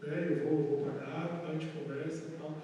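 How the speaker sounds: soft, low calming voice; quiet, soothing apology after a fender bender; calming the lady. Retold or imitated. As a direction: talk her down gently. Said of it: "Aí eu já falei".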